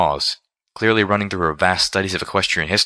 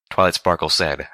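usual speaking voice

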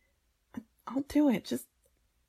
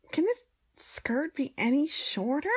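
exasperated; 7 semitones higher, 5 dB louder than usual. Acted. Complaining loudly about short skirt.

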